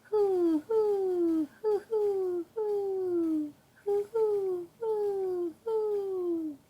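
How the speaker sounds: high, drawn-out owl hoots, each falling; father calling to the owl across the snowy woods, hooting high; owl hoot imitation. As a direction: call like a great horned owl into the night woods. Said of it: "And then Pa called".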